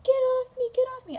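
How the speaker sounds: high, loud mock scream; imitated girl's scream. Neighbor girl fighting off her attacking brother, high imitated scream. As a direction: re-enact a girl's scream, held back from full force. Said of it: "hearing a girl screaming".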